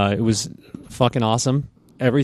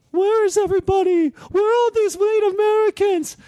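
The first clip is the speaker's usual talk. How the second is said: much higher, panicked mother-in-law voice; frantic mother of the groom. Retold or imitated. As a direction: squeal it like a flustered mother hunting for guests. Frantic groom's mother running around.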